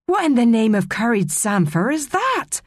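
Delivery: high, swooping cry of disbelief; shocked cry. A narrator's reading. A mermaid spots Maria in the cavern, crying out appalled. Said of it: "Someone cried".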